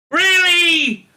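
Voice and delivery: high, loud sarcastic disbelief; incredulous shout. Real, not acted. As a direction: hurl a scornful really. Incredulous outburst at tokenism.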